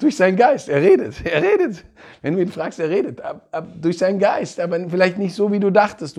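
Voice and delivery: high, laughing delight; laughing joy. Real, not acted. Preacher delighting in how Jesus answers, laughing and high. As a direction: bubble over with a laugh at the answer. Joyful reveal of God's answer.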